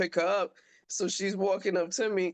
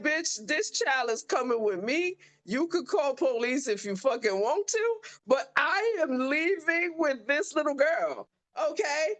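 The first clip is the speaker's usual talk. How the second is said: higher-pitched, defiant and final; defiant retort; 7 semitones higher than usual. Retold or imitated. Mom telling the aide she is leaving with her daughter, high defiant voice. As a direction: lay down the law, refusing to back off.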